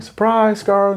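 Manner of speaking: high, excited party yell; high-pitched surprise call; mock surprise shout. Guests yelling surprise at party.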